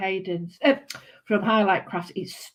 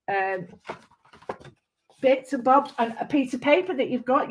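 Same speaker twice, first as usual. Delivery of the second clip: ordinary talk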